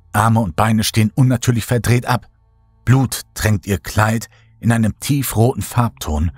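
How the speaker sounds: plain reading voice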